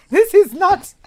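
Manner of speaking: high, laughing through the words; giggling uncontrollably. Real, not acted. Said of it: "I don't know why I'm laughing".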